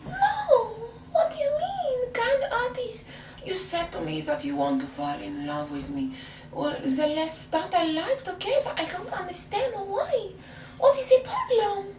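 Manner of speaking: put-on high, wounded French-accented protest; hammed-up indignant French girlfriend. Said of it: "I spoke in a French accent".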